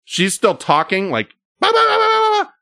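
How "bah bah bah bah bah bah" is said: high, laughing nonsense chatter; mock babble. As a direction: turn her talk into shrieking gibberish. She keeps talking, mocked as babble.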